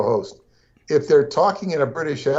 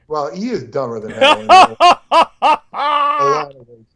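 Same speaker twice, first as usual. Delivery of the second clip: laughing; 11 semitones higher, 7 dB louder than usual. Real, not acted. Laughing while mocking Carville.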